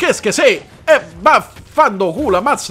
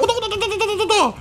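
high, soft rapid chant of nonsense hits; combo chant; 9 semitones higher, 5 dB quieter, much faster than usual. Pretend. Gamer voicing each hit of his combo, high rapid chant. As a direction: rattle off the hits in a little high voice.

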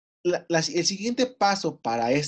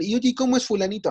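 lightly voiced student, higher and quicker; quick, higher-pitched casual question; curious student asking; 6 semitones higher, 3 dB louder, faster than usual. Acted student asking about a teacher.

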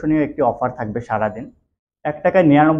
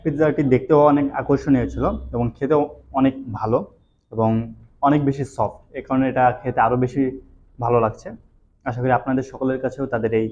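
chewing between words; eating while talking; mouth full of pizza. Real, not acted. Seen 0: Holds pizza, bites around 3-4s, mouth working while speaking.

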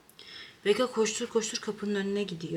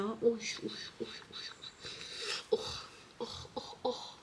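soft cooing; 3 semitones higher, 11 dB quieter than usual. Real, not acted. Cooing noises at cat.

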